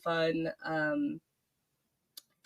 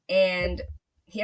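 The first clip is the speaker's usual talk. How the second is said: normal talking voice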